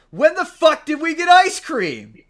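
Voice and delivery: high, loud, slowed blurt of disbelief; incredulous outburst. Retold or imitated. Teammate blurting out at the fiance's ice cream lie, loud disbelief. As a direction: yell it like you honestly can't believe the lie. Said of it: "one of the guys goes".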